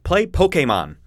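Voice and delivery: "Pokemon" as poke-ay-mon, announcer style; commercial pronunciation. Retold or imitated. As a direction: say "Play Pokemon" like a TV ad. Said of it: "They're like".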